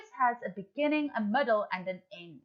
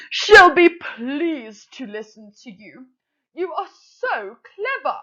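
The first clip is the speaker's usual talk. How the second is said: a proud, gushing crow mother, pitched up; Madam Crow voice; 11 dB louder than usual. Acted. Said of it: "I'm going to put on a voice".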